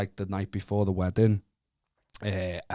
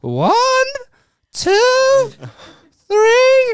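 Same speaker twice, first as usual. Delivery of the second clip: much higher, louder squawked count; nervous parrot-like count; 10 dB louder, much slower than usual. Retold or imitated. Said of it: "He's a parrot".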